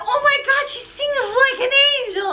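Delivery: very high-pitched gushing; awestruck onlookers. Acted. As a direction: gush in hammed-up awe. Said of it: "And they're like".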